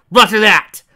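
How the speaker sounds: high, loud roger that; loud confirmation. Acted. Agent shouting back to her handler, high and loud. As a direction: shout the acknowledgment.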